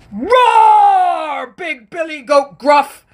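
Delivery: high loud hammed-up troll shout; mock monster yell; troll roar. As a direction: roar a challenge at the goat. Troll challenges big goat.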